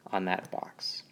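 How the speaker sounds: whisper. Shown as a demonstration. Acting out pushing the box.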